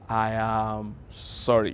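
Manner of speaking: slow, drawn-out, earnest "I am sorry"; slow sincere apology. Shown as a demonstration. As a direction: let every word sink in, heartfelt.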